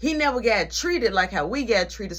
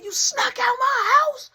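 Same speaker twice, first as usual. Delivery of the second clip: high, loud, slow outraged shout; 6 dB louder than usual. Retold or imitated. Nana bursting into the room, a high furious shout. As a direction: burst in yelling it.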